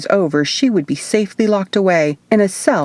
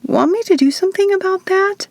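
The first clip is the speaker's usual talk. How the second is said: much higher, eager little witch. A narrator's reading. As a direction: offer it sweetly. Witch offering help, very high.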